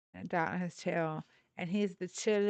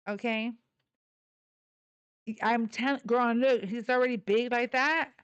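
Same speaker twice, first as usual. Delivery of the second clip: usual speaking voice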